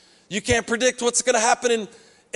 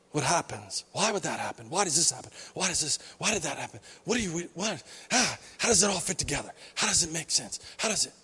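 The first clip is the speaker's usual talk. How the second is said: much softer, lower musing questions; quiet wondering; 6 semitones lower, 9 dB quieter than usual. Acted. People privately wondering about life, soft and low. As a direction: murmur the questions to yourself.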